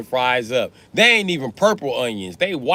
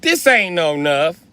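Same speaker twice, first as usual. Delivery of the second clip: put-on toddler complaint; 6 semitones higher, 4 dB louder than usual. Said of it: "They be like".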